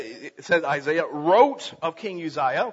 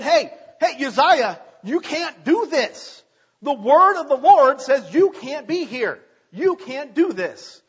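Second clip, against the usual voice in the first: urgent warning; 5 semitones higher, 5 dB louder, slower than usual. Retold or imitated. Priest voiced warning the king.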